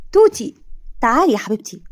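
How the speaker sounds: high, loud call of her name. Acted. Ahmed calling to his girlfriend Toti. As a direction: sing out her name gladly.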